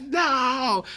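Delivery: high, drawn-out cry of defeat; mock despairing wail. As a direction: wail it high without full volume. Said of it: "I scream at the top of my lungs".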